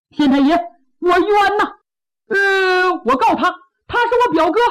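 aggrieved outcry. Acted. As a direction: blurt out the grievance to the magistrate. Plaintiff cries out his grievance. (大个子委屈地高声诉冤)

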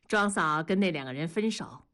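ordinary delivery

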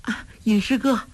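put-on high, girlish cry of alarm; delicate feminine cry. A narrator's reading. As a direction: call out high and dainty, startled. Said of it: "只听得一声娇呼".

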